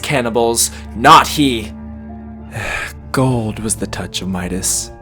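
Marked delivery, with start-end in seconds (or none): 1.8-3.1 soft, long breath out; despairing sigh. Acted. Murderer giving up his plea, despairing sigh. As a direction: sigh away the protest.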